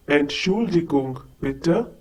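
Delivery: ordinary talk, nothing marked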